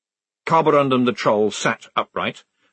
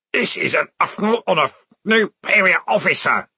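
muffled scream; 8 semitones higher, 4 dB louder than usual. A narrator's reading. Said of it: "screamed the corporal in a muffled voice".

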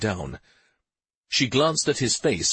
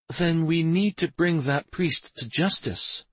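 usual speaking voice

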